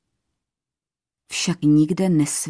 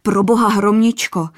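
higher and louder, a frightened outburst; blurted in fright; 5 semitones higher, 5 dB louder than usual. A narrator's reading. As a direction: cry out in alarm for her safety. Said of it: "vyhrkl zděšeně". (vyděšeně vyhrknuté, výš a hlasitěji)

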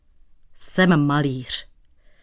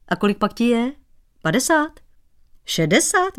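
climbing higher with each guess; teasing prying; 6 semitones higher than usual. Acted. Shoemaker teasing the painter about his age, pitch climbing. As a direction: needle him, rising on every number. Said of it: "vyzvídal Dratvička".

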